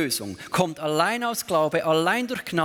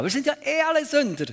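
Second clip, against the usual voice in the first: quick, higher mocking voice of a shrugging believer; mock careless excuse; 8 semitones higher, faster than usual. Preacher mimics lax Christians, quick and mocking. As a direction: toss it off like a carefree excuse.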